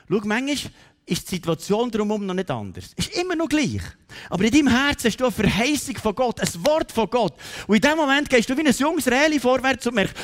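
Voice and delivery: high, loud, building sermon delivery; impassioned preaching. Real, not acted. Preacher urging congregation to hold God's promise, impassioned. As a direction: drive the promise home with rising fervour.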